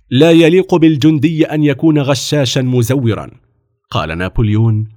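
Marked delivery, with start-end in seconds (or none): 0.1-3.3 louder, raised firm reproof. A narrator's reading. Officer reproving the cheating boy, firmly. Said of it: "قال الضابط في حزم".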